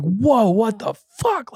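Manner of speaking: high, drawn-out retold shock; jolted by something touching his neck; startled. Hair flicked by icy breath.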